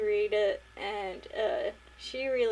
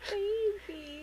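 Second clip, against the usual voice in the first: put-on baby talk; 11 semitones higher, 4 dB quieter than usual. Echoing the cooing at the character.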